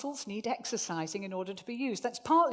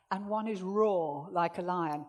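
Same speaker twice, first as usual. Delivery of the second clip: ordinary talk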